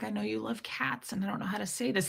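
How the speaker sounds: soft, tentative near-whisper; hushed bad news. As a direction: ease quietly into breaking the news. Retells gently breaking bad news.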